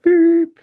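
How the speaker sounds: long, high, loud beep; imitated dial tone. Telling how the label hung up, imitated dial tone. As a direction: mimic a flat phone tone. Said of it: "dial tone".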